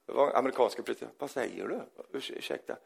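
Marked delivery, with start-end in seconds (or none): 1.2-2.8 soft, half-whispered apology; a startled mumbled excuse; taken-aback president. Retold or imitated. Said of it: "säger presidenten". (presidenten mumlar förvånat, tyst)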